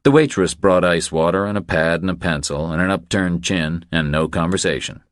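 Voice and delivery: ordinary delivery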